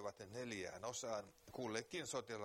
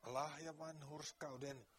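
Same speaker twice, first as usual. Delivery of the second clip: earnest preaching; 3 semitones higher than usual. Real, not acted. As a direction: press the point home to the listener. Preaching continues. (kiihkeä saarnaaminen)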